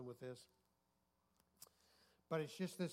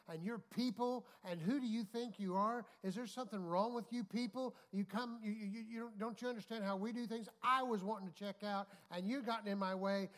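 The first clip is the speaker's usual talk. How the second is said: raised, high-pitched indignant tirade; loud imitation of an irate shopper; mock angry yelling; 7 semitones higher, 6 dB louder than usual. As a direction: act out a woman yelling at a stranger. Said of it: "she's yelling at him".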